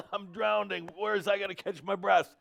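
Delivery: high-pitched drowning panic, hammed up; mock panicked scream. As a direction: act out sudden alarm, voice shooting up high. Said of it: "that scream, like I just did".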